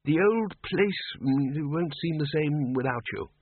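high, slow, hesitant farewell; lightly voiced blustering old wizard; awkward Arch-Chancellor. A narrator's reading. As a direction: force out a polite goodbye. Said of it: "he said".

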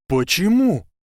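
high, loud outburst; exclaiming. Acted. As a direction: burst out in protest. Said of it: "воскликнул Гарри".

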